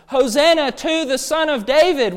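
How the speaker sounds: high, loud acclaim, retold by a preacher; crowd shouting. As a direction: proclaim it like a cheering crowd. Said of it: "what the crowds are shouting".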